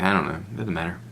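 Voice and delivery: soft, mock shrug-off; dismissive. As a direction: toss it away under your breath. Acts out dismissive afterthought.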